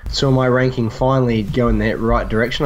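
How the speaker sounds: ordinary talk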